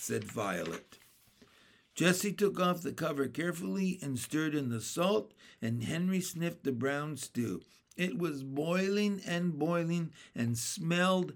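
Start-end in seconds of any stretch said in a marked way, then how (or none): none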